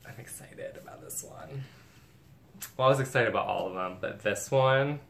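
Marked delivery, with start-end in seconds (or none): 0.1-1.6 very soft, half-whispered anticipation; hushed excitement. Real, not acted. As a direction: confide it almost in a whisper. Hushed excitement before the reveal.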